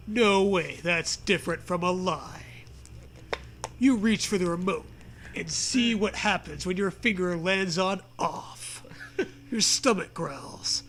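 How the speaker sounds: ordinary delivery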